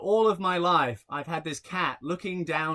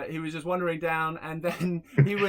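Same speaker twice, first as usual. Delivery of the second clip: usual speaking voice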